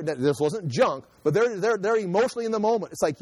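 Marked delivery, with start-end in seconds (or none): none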